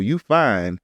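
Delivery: ordinary talk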